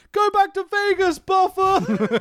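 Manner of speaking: high-pitched yelled heckle; heckling fan. Retold or imitated. Host imitating a fan heckling the announcer, high yell. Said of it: "some fan ... goes".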